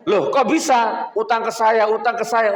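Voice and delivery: shocked disbelief. Retold or imitated. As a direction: react as if you can't believe it. Shocked at the huge debt. (terkejut mendengar utang sebesar itu)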